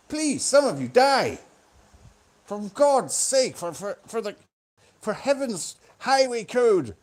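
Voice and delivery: loud, high mock rant; hammed-up desperate appeal; exasperated plea. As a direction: beg at full volume, leaning hard on key words. Mock plea from heaven to the Japanese.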